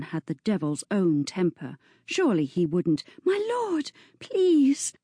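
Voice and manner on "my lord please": high, frightened begging; a girl begging a furious lord to stop; frightened plea. Acted. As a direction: plead with him, scared. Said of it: "The girl's voice held a thread of fear".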